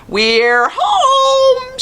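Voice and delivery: very high, loud excited squeal. A narrator's reading. Little sister announcing they are home, very high squeal. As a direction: squeal with delight like a little girl. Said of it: "she squealed".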